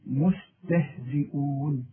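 مستهزئون said slowly, all letters thin. Shown as a demonstration. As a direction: stretch مستهزئون with light vowels. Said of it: "مرققة". (مستهزئون مرققة وببطء)

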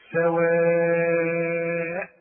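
سواء with the vowel held very long before the hamza; long madd. Shown as a demonstration. Quran teacher demonstrating the connected madd in سواء. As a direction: stretch سواء out long, slow. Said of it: "المد ده مد اسمه مد إيه".

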